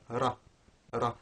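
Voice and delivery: RA with one quick tongue flap; single flapped r. Shown as a demonstration. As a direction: flap the R of RA just once. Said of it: "isolating a single one of those flaps".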